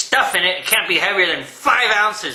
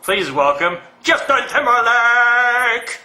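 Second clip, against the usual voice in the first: higher, drawn-out celebrity announcement; 4 semitones higher, slower than usual. Retold or imitated. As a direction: stretch out the guest's name like a hyped host. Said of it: "[name] says people's names in a certain way".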